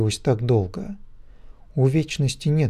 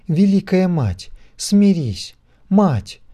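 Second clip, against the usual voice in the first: high, louder, imploring cry; desperate pleading; 7 semitones higher, 4 dB louder, slower than usual. A narrator's reading. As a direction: beg with all her strength. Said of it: "взмолилась она". (отчаянная мольба)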